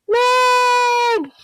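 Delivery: long high, loud call across the store. Acted. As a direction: call out for her as loud as you can. Calling for lost egg.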